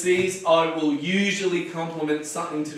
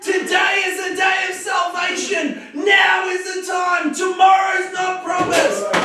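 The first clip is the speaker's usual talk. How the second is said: preaching shout; 12 semitones higher, 4 dB louder than usual. Acted. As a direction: hammer home the call to salvation now. Delivers the sermon punchline.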